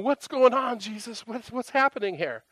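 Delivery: high-pitched exasperated appeal; frustrated and emotional. Retold or imitated. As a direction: act out pleading with Jesus for an explanation. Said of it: "I was getting all frustrated and emotional".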